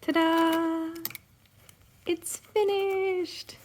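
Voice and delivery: high, drawn-out proud reveal. Real, not acted. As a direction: present it like a prize. Reveal of finished piece.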